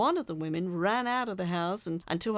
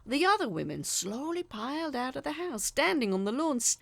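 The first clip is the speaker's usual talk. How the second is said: ordinary delivery, nothing marked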